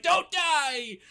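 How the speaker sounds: high, loud wail; hammed-up tearful child's plea; mock crying children. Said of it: "they're all crying".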